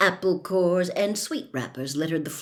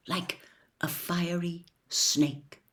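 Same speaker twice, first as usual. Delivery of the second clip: hushed; 2 semitones lower, 6 dB quieter, slower than usual. A narrator's reading. Narrator hushes the vow's climax.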